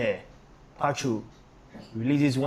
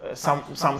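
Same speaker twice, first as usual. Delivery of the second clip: ordinary talk, nothing marked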